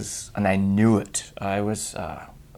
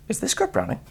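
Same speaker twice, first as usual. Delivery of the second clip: surprised agent; 14 semitones higher, 6 dB quieter than usual. Retold or imitated. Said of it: "they'll be like".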